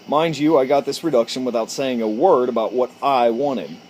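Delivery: ordinary talk, nothing marked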